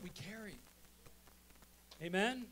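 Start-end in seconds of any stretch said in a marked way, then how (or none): 2.0-2.4 loud, ringing call to the crowd; rousing call. Real, not acted. Preacher urging the congregation to clap, loud and rousing. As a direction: rouse the room to clap.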